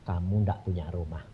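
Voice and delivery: low, soft retold reply; God's calm refusal. God answering the man's plea for a house, low and calm. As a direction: drop low and quiet, matter-of-fact.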